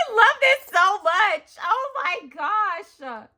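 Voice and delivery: high, loud, slow gushing squeal; freaking out. Real, not acted. Freaking out over a friend's gift, slow high gush. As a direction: melt into drawn-out delight. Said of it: "let me stop freaking out".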